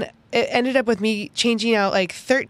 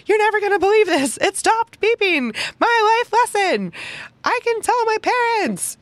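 much higher and louder, giddy disbelief; excited squeal; 14 semitones higher, 3 dB louder than usual. Retold or imitated. Telling roommate Lacey the beeping stopped, giddy high squeal. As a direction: squeal it like the best news ever.

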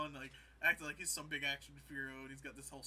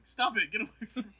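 loud, high, laughing protest; mock panic; 8 semitones higher, 13 dB louder, faster than usual. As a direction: fend someone off in comic alarm. Palpatine panicking as Vader approaches.

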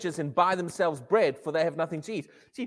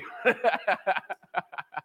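laugh; 3 semitones higher than usual. Real, not acted. Laughing at himself.

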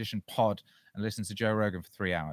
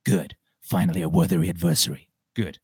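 low, loud hardcore motivator; Jocko Willink; 4 semitones lower, 4 dB louder than usual. Retold or imitated. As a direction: greet disaster like a tough mentor. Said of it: "his thing is good".

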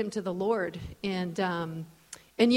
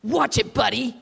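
louder, curt warning; mock saintly snap; 5 dB louder than usual. Mother with baby to a man who bumps her, curt warning. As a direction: warn him off sharply. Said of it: "do my best Mother Teresa imitation".

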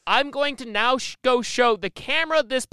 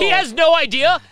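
loud teasing; 8 semitones higher, 11 dB louder than usual. Real, not acted. Mocks clueless player.

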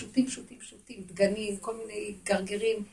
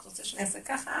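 soft question, rising on ככה; quietly offer, lifting ככה at the end; obliging, hushed asking; acted willing question; 11 dB quieter, faster than usual. Queen asking what king wants.

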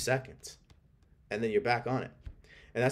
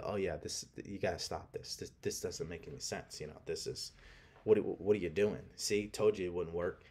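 low, much softer needling voice of doubt; nagging inner voice; 5 semitones lower, 7 dB quieter than usual. Retold or imitated. Inner doubt tells the listener to stop, low and murmured. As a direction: murmur it low like a voice in your head telling you to quit. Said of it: "That voice starts to speak a little louder".

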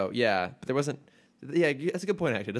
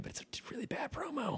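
whispered aside; 12 semitones higher, 13 dB quieter than usual. Real, not acted. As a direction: drop to a whisper. Confiding a harsh opinion.

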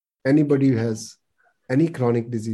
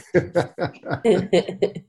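short laugh; 5 semitones higher than usual. Real, not acted. After laughter joke.

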